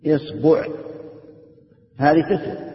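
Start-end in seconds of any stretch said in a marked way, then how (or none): 0.1-0.7 اصبع as "isbu'", i first, u second; i then u. Shown as a demonstration. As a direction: say اصبع as IS-bu'. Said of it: "ثلاث مع كسر الهمزة".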